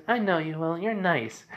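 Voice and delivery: put-on high, trusting child voice; Eri. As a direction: say it like a trusting little girl. Little girl trusts the monster.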